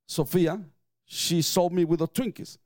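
usual speaking voice

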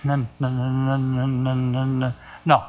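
low, soft stuck stammer on one note; tongue-tied stammer. Retold or imitated. Caller trying to say the name of Jesus, stammering. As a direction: imitate a man straining and failing to get the word out. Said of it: "this is what he did".

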